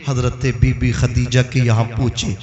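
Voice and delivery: usual speaking voice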